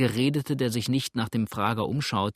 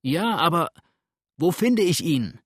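high, loud urgent question; calling back; 5 semitones higher, 5 dB louder than usual. A narrator's reading. Virginian to the captured farmer, loud high call back. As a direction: shout back across the crowd. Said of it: "rief der Virginier zurück".

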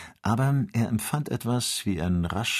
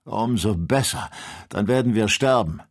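louder, bitter despair; desperate rage; 3 semitones higher, 4 dB louder than usual. A narrator's reading. Kennedy in desperate rage, expecting death. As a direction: spit it out in hopeless fury. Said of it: "mit verzweifelter Wut".

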